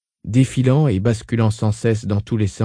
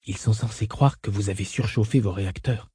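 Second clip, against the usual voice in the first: soft, hushed undertone; low murmur; 7 dB quieter than usual. A narrator's reading. Duncan to Burtzmali while hiding, murmured in a low voice. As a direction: murmur it discreetly, well below speaking volume. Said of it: "Duncan murmura à voix basse".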